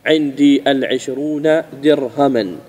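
slow, deliberate درهما with final -an; correct model form. Shown as a demonstration. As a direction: repeat the model slowly, no article on درهما. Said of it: "وإنما تقول إيش؟". (نموذج صحيح متأنٍّ)

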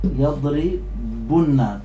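يضربن broken slowly into yaḍri-bunna; syllable-split word. Shown as a demonstration. Teacher shows the remaining damma, slow split yaḍri-bunna in يضربن. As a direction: pull "يضربن" apart before the bunna. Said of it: "فقلنا إيش؟".

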